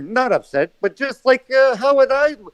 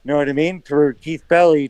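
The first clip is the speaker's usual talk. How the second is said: usual speaking voice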